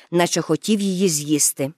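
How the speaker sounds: ordinary delivery, nothing marked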